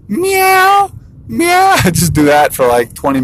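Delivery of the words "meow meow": very high, tiny kitten meows; little kitten. Retold or imitated. Three-year-old meowing at his papa, tiny high kitten voice. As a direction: mew like a child playing kitten. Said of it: "he'll come over and start meowing".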